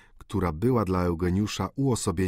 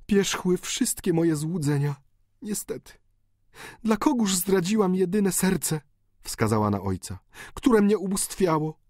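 high, tearful pleading daughter; pleading countess; 9 semitones higher than usual. A narrator's reading. Daughter begging her dying father, high and tearful. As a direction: beg the dying father for forgiveness. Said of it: "countess confesses her ruin".

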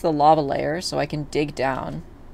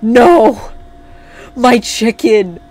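loud, higher cry of dismay; anguished shout; 6 semitones higher, 12 dB louder, much slower than usual. Real, not acted. Player whose chicken just died, anguished shout. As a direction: wail it out over a sudden loss.

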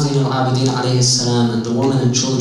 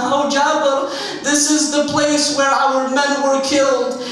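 high-pitched, insistent lament; grieving complaint; 9 semitones higher than usual. Retold or imitated. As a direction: pour out each loss as a rising plea. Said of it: "He begins to complain to Jabir".

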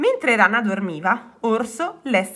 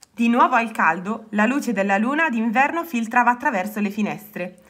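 ordinary delivery

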